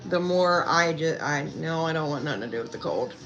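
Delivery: usual speaking voice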